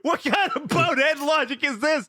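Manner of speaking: high, laughing disbelief; incredulous laughing. Retold or imitated. Retelling his reply to his friend, laughing in disbelief. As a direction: laugh it out in mock outrage. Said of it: "And I'm like".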